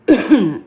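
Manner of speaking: sharp real cough. Real, not acted. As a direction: cough once, hard. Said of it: "Sorry, I'm kinda congested".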